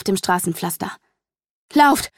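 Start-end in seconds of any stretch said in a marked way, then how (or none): 1.7-2.1 high, loud, short burst of warning; gasped urgent cry. A narrator's reading. Mother urging her child to flee, gasped cry. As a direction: pant out a short, loud command. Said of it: "keuchte meine Mutter".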